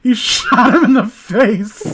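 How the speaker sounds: breaking up with laughter mid-line; can't keep a straight face; slow, loud, laughing through it; laughing while reading. Real, not acted.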